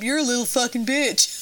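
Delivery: lightly voiced pool bully; retold older kid. Older kid to his friend about the diver, mock taunt. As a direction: imitate a kid putting someone down. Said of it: "And the kid goes".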